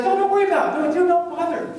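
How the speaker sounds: high, quick, dismissive wife; high airy brush-off. Retold or imitated. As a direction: wave it off lightly and fast. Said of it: "she's like".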